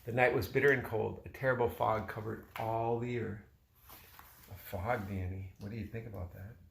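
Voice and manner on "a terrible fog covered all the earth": usual speaking voice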